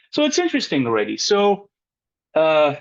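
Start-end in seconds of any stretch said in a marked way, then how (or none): none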